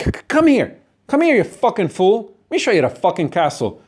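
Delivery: high-pitched, aggressive, stuttering call; angry taunting summons. Acted. Acted angry host showing the castle.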